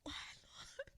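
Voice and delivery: breathless whisper, asking Lord why; a hushed, grieving cry to the Lord; whispered plea to God. Real, not acted. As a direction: whisper the question up to heaven, stricken. Preacher's anguished plea over lynching.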